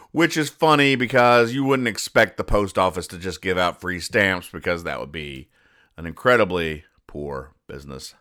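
ordinary talk, nothing marked

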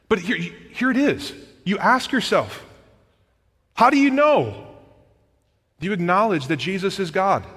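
high, loud, emphatic preacher delivery; impassioned preaching. Acted. Preacher challenging the congregation on their salvation, impassioned. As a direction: drive each question home to the congregation, loud and high.

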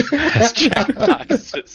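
cracking up at his own punchline; laughing. Real, not acted. Finishing the customs card joke for friends, laughing through it.

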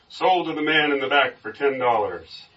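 storyteller's auctioneer call; auctioneer's announcement. Acted. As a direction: announce it to the room. Auctioneer closes the sale.

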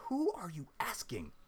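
a high, exasperated demand for who said it; pushing back, voice climbing to a peak; indignant retort. Retold or imitated. At work answering a complaint, a high indignant demand. Retold confronting the boss.

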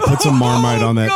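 laughing while saying "Put some Marmite on that"; "Put some Marmite on that" said through laughter. Real, not acted.

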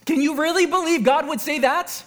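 high, incredulous mock question; outraged disbelief. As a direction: act out scandalised disbelief. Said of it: "expressing outrageous disbelief".

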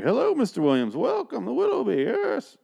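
half-joking villain greeter welcome; Bane-like greeter. Retold or imitated. Townsfolk welcoming Mister Williams, in a mock Bane voice. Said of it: "I don't know why they sound like Bane".

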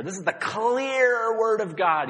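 "This is the clear Word of God", weight on "clear"; stress on clear. Shown as a demonstration. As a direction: make "clear" ring out. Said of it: "I'm emphasizing".

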